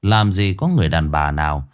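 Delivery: usual speaking voice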